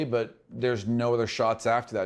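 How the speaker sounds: usual speaking voice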